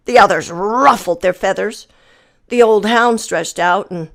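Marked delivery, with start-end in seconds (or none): none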